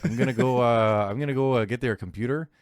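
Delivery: light mock Canadian accent. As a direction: lightly play up a folksy Canadian. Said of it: "He didn't sound Canadian enough".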